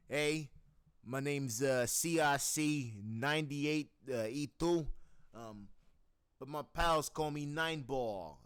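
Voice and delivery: loud, slow, friendly mobster drawl; Eight Ball voice. Shown as a demonstration. Voice actor's first take of Eight Ball, loud and slow. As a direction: greet them like a tavern regular. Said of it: "next we're gonna go with ... Eight Ball".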